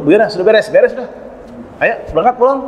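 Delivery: chirpy call to wrap up and go home; cheerful mourner. Retold or imitated.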